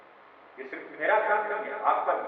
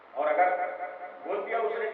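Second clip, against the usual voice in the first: normal talking voice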